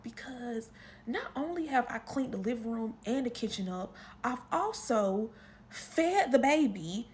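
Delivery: ordinary talk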